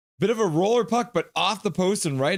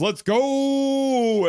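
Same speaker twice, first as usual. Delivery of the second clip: cheer; 11 semitones higher, 4 dB louder than usual. Retold or imitated. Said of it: "K J says".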